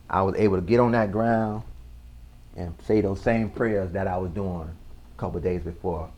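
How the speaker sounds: ordinary talk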